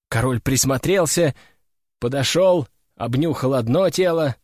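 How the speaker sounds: ordinary delivery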